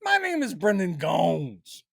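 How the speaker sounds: high, slow hammy introduction; mock introduction. Pretending to introduce himself by the nickname, hammy and slow. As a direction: announce yourself grandly as Brendan Gones.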